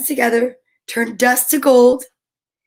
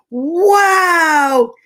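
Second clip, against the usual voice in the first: delighted wow; 3 semitones higher, 6 dB louder than usual. Real, not acted. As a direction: burst out with joy. Reacting to the gift.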